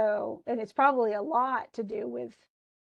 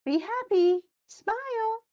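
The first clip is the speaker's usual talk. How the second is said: higher, sing-song mock cheerfulness; mock chirpy cheer; 4 semitones higher than usual. Nora mockingly urges the dying doctor to smile, high chirpy mock cheer. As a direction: lightly parody the forced good cheer.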